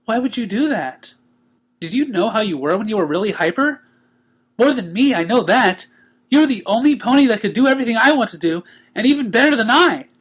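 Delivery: high, cheery pony voice; bright and teasing; Pinkie, joyful. A narrator's reading. Pinkie greeting the waking Twilight, high and cheery.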